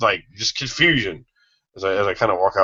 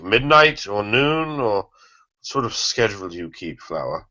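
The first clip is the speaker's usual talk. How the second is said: normal talking voice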